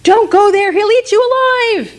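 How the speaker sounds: frightened warning. Acted. Townsfolk warning boy and father off, frightened. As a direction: warn them not to go. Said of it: "And the people said".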